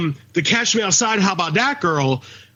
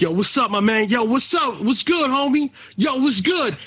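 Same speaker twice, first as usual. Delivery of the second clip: put-on street accent; 4 semitones higher than usual. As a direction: parody of a put-on street accent, rising higher. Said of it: "people that sound like that".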